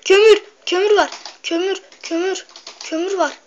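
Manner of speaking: high, loud excited cry of discovery; excited shout. Real, not acted. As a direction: yell it out, thrilled to find it. Finding coal, shouting.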